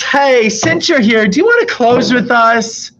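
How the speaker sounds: high, slow, syrupy boss asking a favour; sugary boss. Retold or imitated. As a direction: sweeten it like a boss buttering you up. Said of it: "my boss comes up to me and says".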